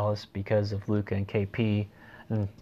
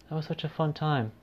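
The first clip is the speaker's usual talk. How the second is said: higher-pitched mock-fond sarcasm; sarcastic; 6 semitones higher, 3 dB quieter than usual. Real, not acted. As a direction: say it as if you loved it, meaning the opposite. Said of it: "That's sarcasm".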